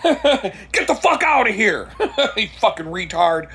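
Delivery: high angry shout breaking into laughter; laughing angry yell. Pretend. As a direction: yell it while cracking up. Pastor voiced yelling, laughing.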